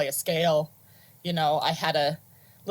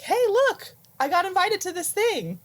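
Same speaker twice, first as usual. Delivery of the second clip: put-on excited kid; 13 semitones higher than usual. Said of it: "was like".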